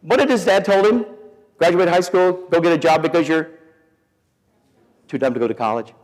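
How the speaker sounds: high, loud mock wife voice; lightly voiced wife, pushing him; wife's bossy quote. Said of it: "She said".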